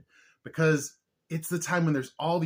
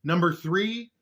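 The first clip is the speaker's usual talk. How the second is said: usual speaking voice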